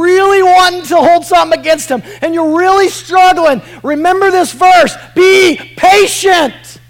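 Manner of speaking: high, very loud preacher's shout; full-force preaching. Real, not acted. Preacher urging the struggling to remember the verse, full-force preaching. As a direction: drive it home loudly, drawing out the last words.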